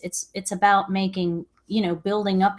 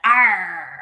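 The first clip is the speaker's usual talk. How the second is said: pirate growl; 4 dB louder than usual. Pretend. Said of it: "call me a pirate".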